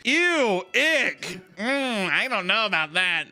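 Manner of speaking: high, prissy, dismissive ick; mock disgusted staff. Hospital staff dismissing a grieving patient, prissy and disgusted. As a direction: sneer it like a bored nurse brushing off a complaint. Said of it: "And they're just like".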